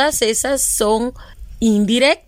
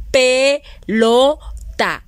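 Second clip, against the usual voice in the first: syllabified slowly; much slower than usual. Shown as a demonstration. Teacher to second graders, slow syllable split.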